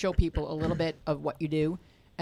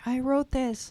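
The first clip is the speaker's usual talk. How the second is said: higher, gleeful boast, lightly voiced; mock proud composer; 8 semitones higher, 3 dB louder, slower than usual. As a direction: crow it like a songwriter showing off. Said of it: "dancing around the house going".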